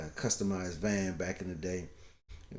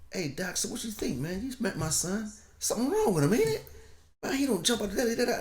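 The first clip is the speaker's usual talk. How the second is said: much higher, pushy incredulous venting to the doctor; exasperated dad; 13 semitones higher than usual. Retold or imitated. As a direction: demand the doc confirm something's wrong. Asking counselor what's wrong with son.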